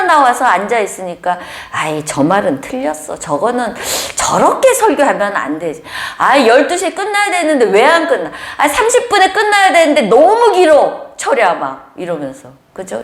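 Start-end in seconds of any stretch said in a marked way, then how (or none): 1.7-11.5 mock impatient grumbler; whiny complaint. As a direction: complain the service drags on.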